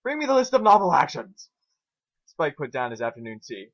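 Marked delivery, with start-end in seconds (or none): 0.0-1.5 loud, high, bossy command; demanding shout. Acted. Twilight shouts an order at Spike, loud demand. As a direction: order him to fetch it.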